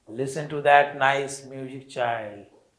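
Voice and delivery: slow, deliberate read-aloud for a class; slow story reading. Acted. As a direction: read each English phrase very slowly and clearly. Teacher reads story text aloud. (धीमी गति से कहानी पढ़ना)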